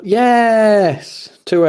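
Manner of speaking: loud, high, long-drawn yes; excited cheer. Real, not acted. Cheering mid-stream, loud and elated. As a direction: let out a big drawn-out cheer. Sudden celebration during the stream.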